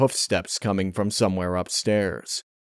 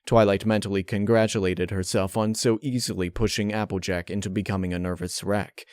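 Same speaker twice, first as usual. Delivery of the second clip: plain reading voice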